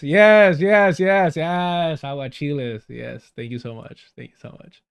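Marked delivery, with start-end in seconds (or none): 0.0-2.0 loud, eager run of yeses; excited yes. Real, not acted. Streamer thanking chat for the forgotten name, loud and excited. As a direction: shout yes over and over as chat finally remembers.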